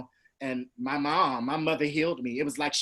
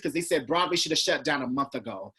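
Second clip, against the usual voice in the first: usual speaking voice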